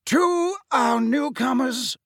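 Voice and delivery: high, slow, ringing welcome. A narrator's reading. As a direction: proclaim it grandly to the hall. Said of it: "said Dumbledore in a ringing voice".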